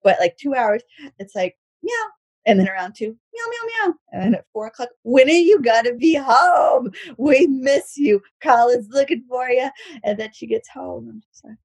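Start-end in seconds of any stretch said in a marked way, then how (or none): 3.1-3.9 string of small kitty calls; repeated meows. Retold or imitated. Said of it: "I meow". 5.0-9.7 high, loud, drawn-out whine; hammed-up clingy wail; needy pleading. Retold pleading message to absent partner.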